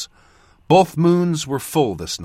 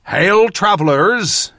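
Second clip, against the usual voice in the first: put-on slow, loud northern-accented hail; thick northern accent; 7 semitones higher, 5 dB louder, slower than usual. A narrator's reading. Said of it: "he said in a thick northern accent".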